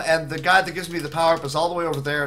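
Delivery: normal talking voice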